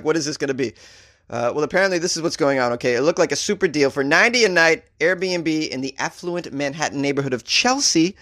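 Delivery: normal talking voice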